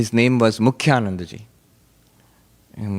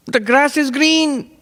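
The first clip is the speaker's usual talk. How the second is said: put-on very high, slow old monk's voice; high-pitched voice; 13 semitones higher, slower than usual. Old monk teaching novices, very high-pitched and slow. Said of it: "He had a high-pitched voice".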